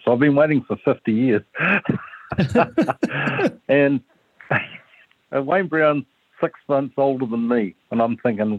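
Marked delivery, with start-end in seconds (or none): 1.6-7.7 laughing. Real, not acted. Laughs while telling the story.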